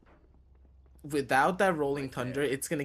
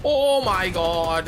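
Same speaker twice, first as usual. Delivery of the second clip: stunned gasp; 4 semitones higher, 7 dB louder, much slower than usual. Real, not acted. Caster reacts to play.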